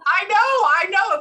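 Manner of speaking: high, loud, laughing agreement; exuberant, giving in to the joke; amused exclaiming. Real, not acted. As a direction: burst out agreeing, pitched well up. Laughing banter with the spirit.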